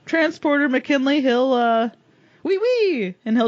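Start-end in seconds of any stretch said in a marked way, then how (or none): 2.4-3.2 a high, silly French "wee wee"; mock French. Game master voicing the chief with a high, hammed-up French "wee wee". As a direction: squeak out "wee wee" like a cartoon Frenchman. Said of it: "let him always and only say wee wee".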